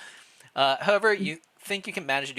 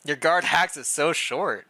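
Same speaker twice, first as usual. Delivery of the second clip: amused; 4 semitones higher, 6 dB louder, slower than usual. Real, not acted. Laughing at short guard name.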